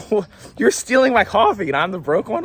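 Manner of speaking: high, disbelieving come-back; can't believe what he's hearing; incredulous retort. Retold or imitated.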